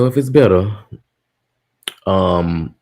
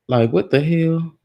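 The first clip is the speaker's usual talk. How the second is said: baffled; 8 semitones higher than usual. Real, not acted. Baffled by audio problem.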